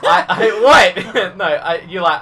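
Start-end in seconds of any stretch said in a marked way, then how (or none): 0.0-0.9 high helpless burst of laughter; laughter takes over the story; cracking up. Real, not acted. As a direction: lose it completely, laughing loud. Breaks up mid-story.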